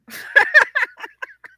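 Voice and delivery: a squealing laugh that jumps high. Real, not acted. Laughing at her own story about her husband, high and squealing. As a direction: burst into a shrill giggle.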